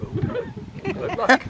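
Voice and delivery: high, sing-song sarcastic good luck; mock good luck. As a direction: tease him with a drawn-out ooh. Sarcastic reply to dog owner.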